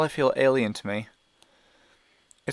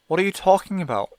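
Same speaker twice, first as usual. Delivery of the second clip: a sudden lift of energy, louder and higher; new energy; 6 semitones higher, 5 dB louder than usual. Acted. Mother suddenly urgent with Evan, energized and louder. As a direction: grief turns suddenly urgent. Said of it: "with new energy in her voice".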